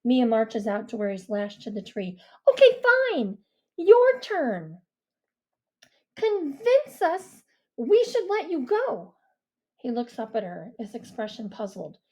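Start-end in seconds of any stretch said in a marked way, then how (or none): 2.5-9.1 put-on higher girl's voice, firm and loud; stern girl's challenge; commanding girl. A narrator's reading. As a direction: Mia taking charge. Mia challenging Deke.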